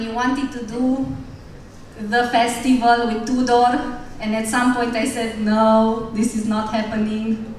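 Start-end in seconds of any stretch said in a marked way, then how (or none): none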